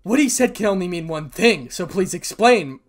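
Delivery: demanding. Acted. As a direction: press them for an explanation. Friend demands an explanation.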